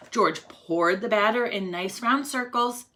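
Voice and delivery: ordinary delivery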